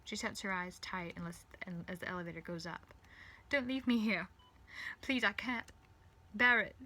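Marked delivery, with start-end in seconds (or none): 3.5-6.8 loud, high desperate begging; urgent cry not to be abandoned; pleading. Acted. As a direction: plead in broken-up phrases. Begging Rose not to leave.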